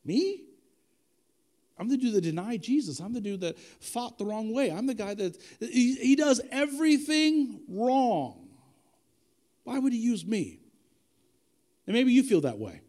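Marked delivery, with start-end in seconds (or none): 0.0-10.6 lightly acted self-doubt building to emphatic; disbelieving Peter. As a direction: wonder aloud at being chosen. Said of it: "he's thinking this whole time".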